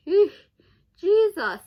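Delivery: cringing outburst; loud disgusted exclamation. Real, not acted. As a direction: recoil out loud at something creepy. Reacting to creepy cartoon clips.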